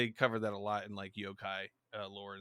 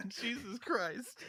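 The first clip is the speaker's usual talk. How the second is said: laughing; 10 semitones higher, 5 dB quieter, much slower than usual. Real, not acted. Reacting to dub voices.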